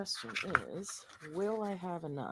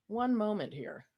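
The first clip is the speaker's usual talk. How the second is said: normal talking voice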